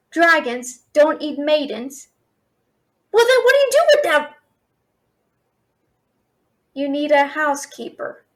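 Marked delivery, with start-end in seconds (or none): 3.1-4.3 high, loud and fast, in disbelief; incredulous. Real, not acted. To the dragon, stunned that it doesn't eat maidens, incredulous fast blurt.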